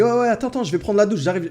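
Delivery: lightly voiced kid brother stalling from the shower; little brother. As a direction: voice the little brother putting him off.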